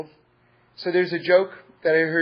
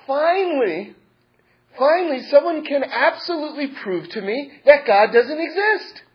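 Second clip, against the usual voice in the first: higher, rising with eager delight; eager rabbi; 7 semitones higher than usual. Acted. Rabbi tells the student he can finally have proof, eager. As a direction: build up thrilled, soaring at the end.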